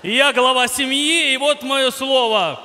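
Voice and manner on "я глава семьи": ordinary talk, nothing marked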